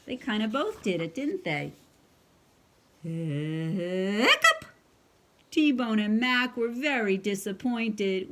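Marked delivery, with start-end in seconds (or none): 3.0-4.6 crying hiccup. Acted. Clifford hiccups, sad.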